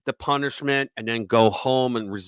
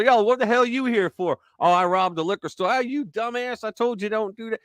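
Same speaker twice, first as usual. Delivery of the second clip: higher, mock street banter between buddies; joking defendants; 7 semitones higher than usual. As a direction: tease your buddy, voice pitched up. Defendants joking in courtroom hallway.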